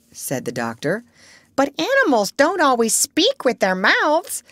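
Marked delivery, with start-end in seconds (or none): 1.6-4.4 high-pitched parrot; high, emphatic parrot voice. A narrator's reading. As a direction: pipe it up high like an indignant bird. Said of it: "in a high voice".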